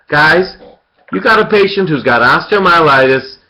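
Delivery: usual speaking voice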